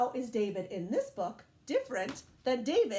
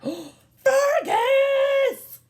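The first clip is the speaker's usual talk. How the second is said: startled owner yells; shocked shout; 13 semitones higher, 12 dB louder than usual. Acted. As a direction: gasp then a loud, high shout of the name. Dog gets into the plant.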